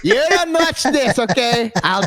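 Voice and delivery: high, slow mock reprimand; drawn-out, hammed-up parental scolding; scolding dad. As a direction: imitate a father laying down the law. Friend plays disapproving dad.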